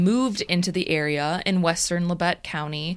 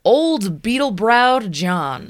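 slow, louder grand reveal; dramatic reveal; 3 semitones higher, 6 dB louder, slower than usual. Acted. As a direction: announce it slow like a big reveal.

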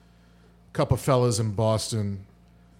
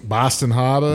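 put-on Boston accent, dropped r in Harbor; broad Boston-accented place name. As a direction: say Harbor as "Hah-buh", Boston style. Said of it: "that's the best accent".

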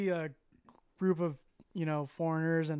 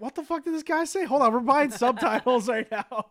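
higher and louder baffled outburst, laughing at the end; 7 semitones higher, 7 dB louder than usual. Retold or imitated. Host recalls not understanding the Australian, loud baffled outburst. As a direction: blurt it out in disbelief. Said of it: "And I was like".

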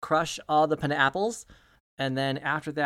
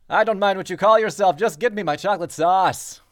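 much louder and higher, haughty; spoiled prince ordering chocolate sauce over intercom; pompous prince again; 5 semitones higher, 10 dB louder than usual. Acted. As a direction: dismiss the servant with lofty command.

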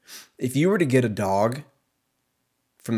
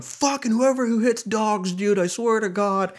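much higher, worked-up rant; ranting guy; 11 semitones higher than usual. Retold or imitated. A podcaster ranting about people who hit dogs, mock rant. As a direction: fume about dog abusers. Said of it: "I just have a full podcast".

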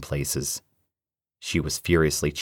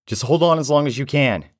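loud, high shout back; called back; 11 semitones higher, 6 dB louder than usual. Acted. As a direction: yell encouragement over the noise. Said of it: "he called back".

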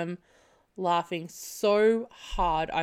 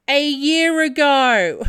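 loud slow blunt answer; loud slow blunt reply; loud quote; 5 semitones higher, 10 dB louder, slower than usual. Retold or imitated. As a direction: say it flat out and loud. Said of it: "To which she responds".

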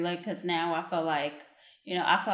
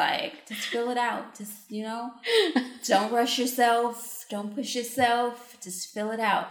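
calm voice; 5 semitones higher than usual. Retold or imitated. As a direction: coax gently, unhurried. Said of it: "And it's in calm voice".

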